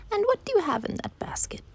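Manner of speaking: very high, airy wolf voice; pry in a high, half-whispered voice; story wolf. Acted. Wolf asks the girl about her basket, high whispery prying.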